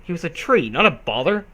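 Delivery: usual speaking voice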